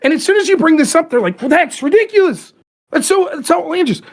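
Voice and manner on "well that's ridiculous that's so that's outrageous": high-pitched, scandalised protest; mock outrage. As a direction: mimic an indignant opponent, pitched up. Mocking opponents' outraged reply.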